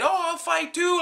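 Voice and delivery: put-on very high squeaky kid voice; tiny child piping up to fight; little kid. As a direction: play a brave little kid. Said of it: "A little kid".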